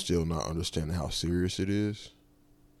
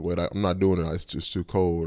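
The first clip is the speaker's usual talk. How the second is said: loud protest; 8 dB louder than usual. Retold or imitated. Refusing to leave the car.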